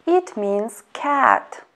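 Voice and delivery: usual speaking voice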